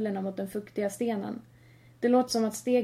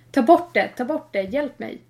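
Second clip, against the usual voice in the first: loud terrified cry, fading; shrieking in panic then gasping pleas; panicked scream; 3 semitones higher, 8 dB louder than usual. Acted. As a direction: scream for help as threads grab him. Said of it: "Sen börjar Andreas skrika".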